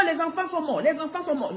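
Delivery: put-on high, distressed cry that the children died; a touch of retold panic; panicked news. Caller announces the children died.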